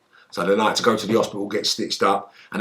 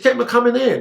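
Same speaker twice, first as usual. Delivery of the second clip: bossy demand; 11 semitones higher, faster than usual. Retold or imitated. Repeated demand, louder.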